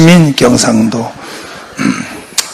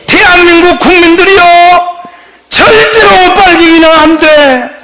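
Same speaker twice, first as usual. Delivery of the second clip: high, ringing mock rally cry; slow, drawn-out high-pitched appeal; impassioned oratory; 8 semitones higher, 4 dB louder than usual. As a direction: imitate an orator pleading with the whole nation. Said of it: "눈물의 강연을 해요".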